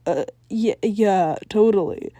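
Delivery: ordinary delivery, nothing marked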